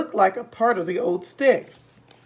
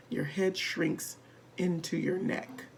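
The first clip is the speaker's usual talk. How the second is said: slow, much softer, lower; hushed spooky telling; 3 semitones lower, 11 dB quieter than usual. A narrator's reading. Husband quietly frightening wife. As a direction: lower your voice to frighten her.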